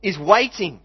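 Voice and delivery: high, loud and emphatic sermon voice; impassioned preaching. Real, not acted. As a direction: preach it with soaring wonder. Preaches with rising excitement.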